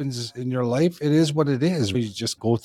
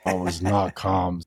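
ordinary talk

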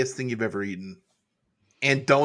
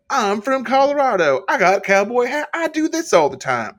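high, loud mock Colorado cowboy brag; 9 semitones higher, 6 dB louder than usual. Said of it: "Malarkey was like".

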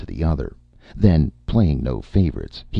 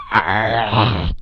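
loud high roaring shout; brutish roar; 8 semitones higher, 5 dB louder than usual. A narrator's reading. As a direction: roar out in fury. Brute roaring in cell.

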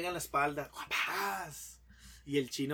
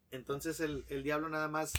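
ordinary talk

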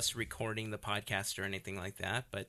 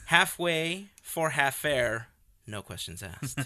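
tour guide spiel; 5 semitones higher, 6 dB louder than usual. Acted. Recites Jungle Cruise skipper spiel.

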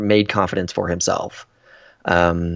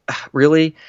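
mildly put-out, higher-pitched reaction; upset; 6 semitones higher than usual. Retold or imitated. Woman reacting to date's excuse, mildly upset. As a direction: imitate someone a little hurt and incredulous. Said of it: "you can tell that she's upset".

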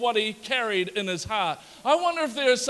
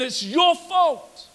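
higher, louder, slow and pointed; accusing; 6 semitones higher, 5 dB louder, much slower than usual. Acted. Nehemiah to the king, slow loud accusation. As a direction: lay the blame on the king.

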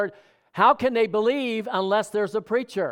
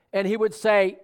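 ordinary talk, nothing marked

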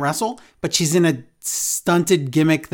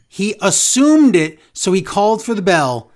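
ordinary talk, nothing marked

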